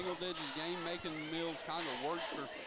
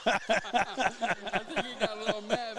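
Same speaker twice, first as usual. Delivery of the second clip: laughing; 5 semitones higher, 9 dB louder, slower than usual. Real, not acted. Laughing at player's reaction.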